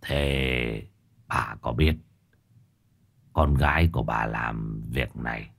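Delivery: put-on old Hậu questioning Lụa in a low murmur; old man Hậu. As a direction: speak low and quiet as an old sorcerer. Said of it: "Lão Hậu hỏi bà Lụa".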